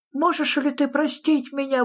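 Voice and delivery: high-pitched pleading exclamation; nephew's high, imploring voice; exclaiming. A narrator's reading. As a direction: cry out for forgiveness in a high character voice. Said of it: "воскликнул его племянник".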